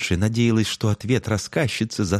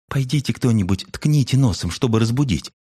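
higher, eager cub voice; young cub voice; 6 semitones higher than usual. A narrator's reading. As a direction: pipe up like a small cub. Younger fox cub suggests.

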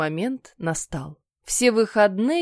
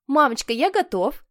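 higher, sugary goody-goody voice; sweet eager boy; 5 semitones higher than usual. Acted. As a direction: butt in like a teacher's pet. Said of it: "встрял послушный Питер".

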